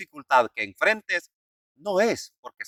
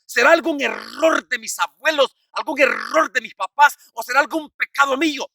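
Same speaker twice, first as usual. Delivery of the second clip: higher-pitched imitation of a troubled parishioner; feligrés angustiado; 8 semitones higher, 3 dB louder than usual. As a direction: act out a worried plea to the priest. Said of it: "con este otro problema y dice". (imitando a un feligrés preocupado, en tono agudo)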